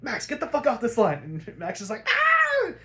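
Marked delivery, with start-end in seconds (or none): 0.0-1.4 gruff shout. Retold or imitated. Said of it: "and Bruce is like". 2.1-2.7 high, loud short shriek; hammed-up scream of fright; mock scream. Max screams in retold scene.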